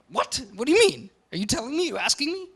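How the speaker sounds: soft, high, confused back-and-forth, barely voiced; mock puzzled listener. A lecturer voicing a confused listener in a soft, high, puzzled tone. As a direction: play the baffled listener, half under the breath. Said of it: "I live in England".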